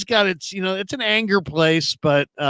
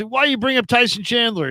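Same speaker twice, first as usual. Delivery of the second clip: higher-pitched imitation of a radio host's challenge; mock ripping into someone; 8 semitones higher than usual. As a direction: rip on the caller, retold. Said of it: "he's kinda ripping on the guy".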